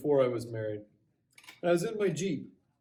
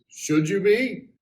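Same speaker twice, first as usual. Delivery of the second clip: louder, higher, drawn-out question; mock suspicious challenge; 6 semitones higher, 9 dB louder, slower than usual. Patrolman challenges driver asking about arrest, mock-pointed question. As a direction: lightly play a cop throwing the question back.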